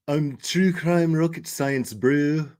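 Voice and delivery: lightly imitated South African accent. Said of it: "I feel like that's a South African accent".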